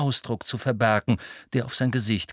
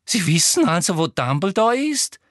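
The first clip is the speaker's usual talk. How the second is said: higher, sugary-eager Umbridge; 8 semitones higher, 4 dB louder than usual. A narrator's reading. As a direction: voice the prying Umbridge. Voices Umbridge. (Umbridge mit hoher, neugieriger Stimme)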